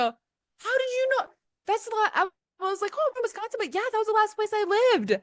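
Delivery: hammed-up astonished tourist; shook. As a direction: play it dumbfounded. S0 replays her shocked replies.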